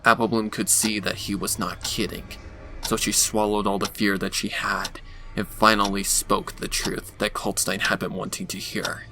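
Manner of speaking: ordinary delivery, nothing marked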